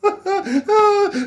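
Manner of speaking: high quick laugh. Real, not acted. Host laughs at the warm up line, high laugh. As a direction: crack up.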